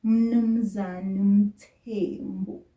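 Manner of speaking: "Mnumzane" drawn out very slowly; slow greeting. Shown as a demonstration. Teacher greets Mister Mthembu slowly for learners. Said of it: "Let me greet him again".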